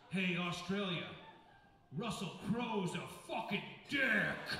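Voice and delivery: mock stage announcement, laughing; announcing to the crowd. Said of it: "he goes".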